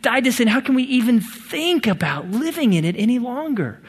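moral appeal. Acted. Preacher voicing Paul's challenge to believers, incredulous moral appeal. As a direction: climb in pitch toward a disbelieving "Why would you do that?". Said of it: "He's making a moral appeal".

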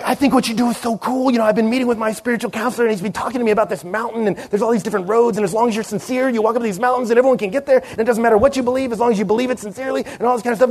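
mild imitation of a chatty woman at dinner; lightly voiced woman.

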